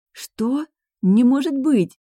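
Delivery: high, loud, thrilled disbelief; joyful cry. A narrator's reading. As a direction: cry out in delighted amazement. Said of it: "С радостным криком". (радостный изумлённый вскрик)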